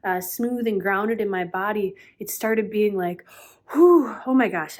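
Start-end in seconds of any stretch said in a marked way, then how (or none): 3.7-4.8 loud, higher exhaled exclamation; amped-up "whew", punched out high and loud; hyped up. Acted. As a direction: blow off the excess excitement. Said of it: "I felt really hyped up".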